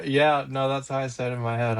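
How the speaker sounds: usual speaking voice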